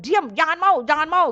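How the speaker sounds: sharp retold order to stop; urgent forbidding. Inner voice forbidding her to go forward, urgent command.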